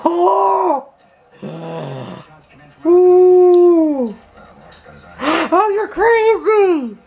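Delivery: high squealing shock; shrieking excitedly. Real, not acted. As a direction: shriek with disbelief. Friend reacts to pepper eating.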